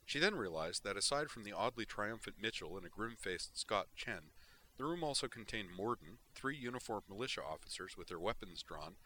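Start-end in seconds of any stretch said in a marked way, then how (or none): none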